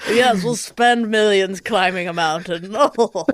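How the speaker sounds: hammed-up English club member; playful English toff impression; English accent. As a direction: put on a mock English accent, chuckling along. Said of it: "if it were in England, you could hear them going".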